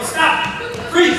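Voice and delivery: sudden high, loud yell to freeze play; shouted stop. Real, not acted. Coach yelling at players to freeze mid-drill, loud and high. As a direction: bark the stop at the whole floor.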